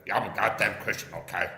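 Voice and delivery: loud mocking impression of an impression; Poon. Comic mimicking a rival who mocks him, loud and mocking. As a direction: punch it out loudly in mockery. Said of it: "That's me doing an impression of Poon".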